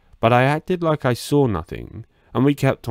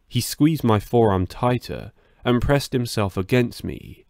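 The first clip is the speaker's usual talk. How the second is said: usual speaking voice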